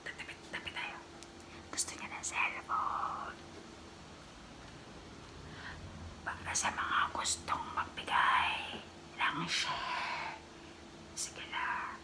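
hushed secret whisper. Real, not acted. Secret asking viewers for gifts.

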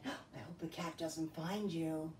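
a gasp, then whisper the worry; hushed whisper. Pretend. Hiding mice from the cat.

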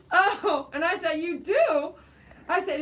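real laughter, high and loud; laughing. Real, not acted. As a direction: crack up while answering. Laughing at blind viewer's story.

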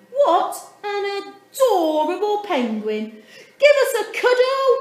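very high, loud, fast gushing; gushing man. A narrator's reading. Big man gushes at Dudley, loud and fast. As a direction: coo and gush over the penguin. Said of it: "he cried".

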